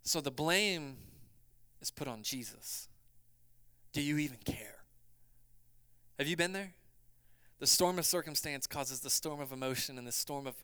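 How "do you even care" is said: hushed doubting whisper. Acted. Preacher whispering the disciples' doubt.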